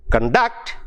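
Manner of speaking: cun-DUCT, reduced first syllable, rising end; second-syllable stress on conduct. Shown as a demonstration. Teacher repeating the verb example, stressed con-DUCT. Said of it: "the second syllable stress".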